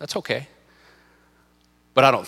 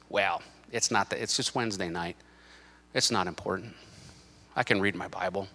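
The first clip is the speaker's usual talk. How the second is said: low, soft self-excusing murmur; inner voice; 6 semitones lower, 9 dB quieter than usual. Acted. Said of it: "because your voice said".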